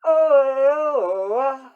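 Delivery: high, loud, fading yell; mock yell. Shop class yelling continues.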